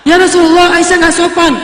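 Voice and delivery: a father scolding his daughter in front of her husband; angry complaint. Retold or imitated. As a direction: complain sharply to the Prophet. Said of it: "Abu Bakar marah kepada Aisyah".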